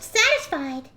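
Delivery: normal talking voice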